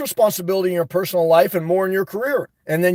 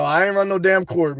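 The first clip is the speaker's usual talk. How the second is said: normal talking voice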